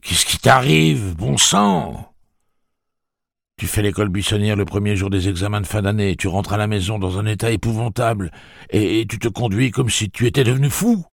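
lightly voiced desperate father; loud pleading father.

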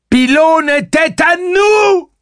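high, loud, drawn-out howl of rage; furious high-pitched yell; enraged scream. A narrator's reading. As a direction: scream it as if half mad with fury. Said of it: "hurla-t-elle, rendue quasi folle de rage".

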